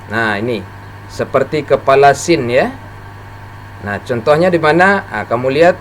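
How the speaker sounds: usual speaking voice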